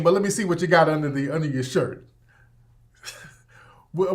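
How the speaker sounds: usual speaking voice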